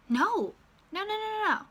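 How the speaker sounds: high, rising panicked no's; mock alarm as the GPS turns off; panicking. GPS sends her off the route, she panics, high panicked no's. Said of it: "And then I'm panicking".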